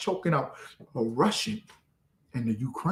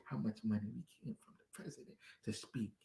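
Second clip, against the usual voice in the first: ordinary talk, nothing marked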